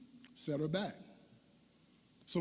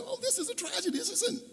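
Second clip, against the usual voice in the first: laughing. Real, not acted. Laughing at parents' joy.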